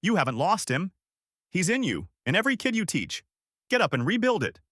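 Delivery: high-pitched urgent urging; rally them insistently, pitched well up; restrained yell of encouragement; mild yell back. A narrator's reading. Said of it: "yelling back".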